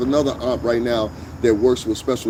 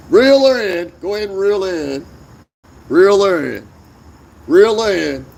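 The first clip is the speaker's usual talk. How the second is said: higher, louder call to the crew; 7 semitones higher, 5 dB louder than usual. Acted. Host calling to the boys that they got one, calling out. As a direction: call it out like landing a catch. Acts out hooking a catch.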